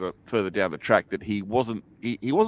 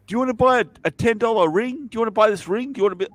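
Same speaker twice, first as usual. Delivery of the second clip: high-pitched pushy hawker voice; pushy street seller; 10 semitones higher, 3 dB louder, faster than usual. Retold or imitated. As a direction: pester them to buy a ring. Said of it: "this guy just walked up to us and said".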